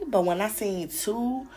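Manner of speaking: normal talking voice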